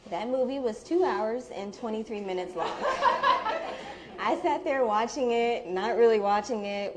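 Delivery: laughing breathily while telling the story; laughing through it. Real, not acted. To friends, recalling the awkward long movie, laughing. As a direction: let real laughter spill through the words.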